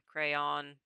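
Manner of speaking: "Crayon" said as cray-awn; rhymes with dawn. Shown as a demonstration. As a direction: say "Crayon" with the second syllable like dawn. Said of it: "Second syllable rhymes with dawn".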